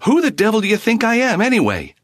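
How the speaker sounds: sudden loud high outburst; blurted out. A narrator's reading. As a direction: blurt it out, pitch jumping. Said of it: "he blurted".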